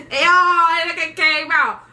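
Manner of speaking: the triumphant end of a sick story; exclaimed relief. Real, not acted. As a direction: drag it out like a big reveal. Climax of the story.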